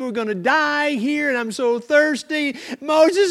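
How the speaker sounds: loud high wailing complaint; whining Israelites. Acted. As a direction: wail like thirsty grumblers.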